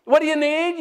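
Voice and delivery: ordinary talk, nothing marked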